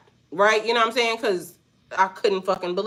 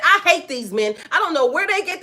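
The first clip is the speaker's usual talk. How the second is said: lightly voiced, higher-pitched exasperation; imitated fed-up woman; 7 semitones higher, 3 dB louder than usual. Store worker complaining about these men, imitated exasperation. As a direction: lift the pitch for her mild mock outrage.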